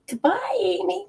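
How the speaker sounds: high, light and cheerful farewell; bright goodbye. Shown as a demonstration. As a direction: sing out a sweet goodbye. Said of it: "say, say".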